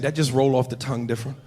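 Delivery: ordinary delivery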